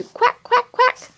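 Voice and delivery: hammed-up duck voice; duck quack. Shown as a demonstration. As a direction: squawk it like a duck. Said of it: "animal noises".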